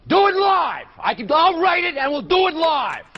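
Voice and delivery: furious shout. Real, not acted. Host loses temper on air.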